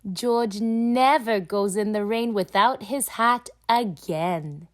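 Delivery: usual speaking voice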